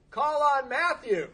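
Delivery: high, loud, drawn-out heckle; mock calling out. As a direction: sing out across the room, slowly. Sponsor calls out in meeting.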